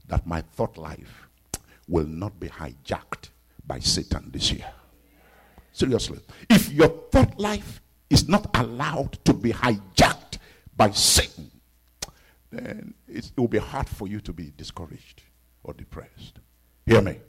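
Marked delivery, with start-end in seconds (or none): none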